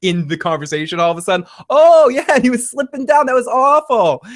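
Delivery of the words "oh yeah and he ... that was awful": much higher, louder mock enthusiasm; hammed-up caring Mikey, high and loud; eager Mikey. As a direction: gush over the grisly detail. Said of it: "Suddenly, Mikey starts caring about it".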